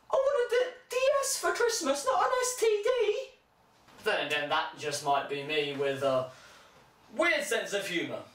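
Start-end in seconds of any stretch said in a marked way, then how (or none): 0.1-3.3 very high, put-on kid voice; whiny child. A kid complaining to his mum about his present, very high. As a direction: squeal it like an outraged child.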